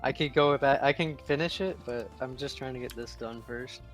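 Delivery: ordinary talk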